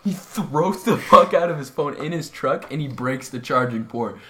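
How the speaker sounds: normal talking voice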